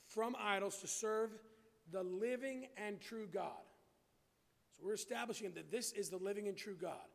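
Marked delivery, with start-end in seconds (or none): 0.0-3.6 slow, deliberate scripture reading. Real, not acted. Preacher walking the congregation through the verse, slowly. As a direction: measure out each phrase with pauses. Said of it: "I'm gonna read it slowly".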